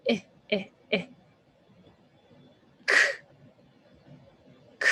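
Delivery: "Eh" then "K" as single sounds; sounding out words. Shown as a demonstration. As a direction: isolate the sounds "eh" and "K". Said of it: "Sounds like a K".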